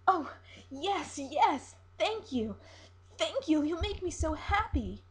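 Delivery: high, bright, breathless thanks from a lovestruck siren; giddy siren. Acted. Siren thanking the captain who promises her legs, high and overjoyed.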